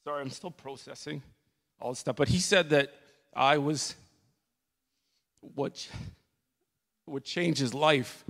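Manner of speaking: choked up, barely getting words out; crying. Real, not acted. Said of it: "the reason why I'm crying about that".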